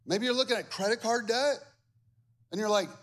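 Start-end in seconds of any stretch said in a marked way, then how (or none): none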